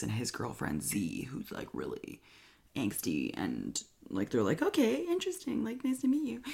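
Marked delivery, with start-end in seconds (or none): 4.6-6.4 awkward politeness. Retold or imitated. Siblings greeting awkward angsty girlfriend.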